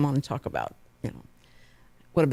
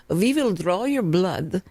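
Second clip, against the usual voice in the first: slow, imitated thick Croatian accent; mock Croatian doctor's accent, drawn out; slower than usual. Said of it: "her accent so thick".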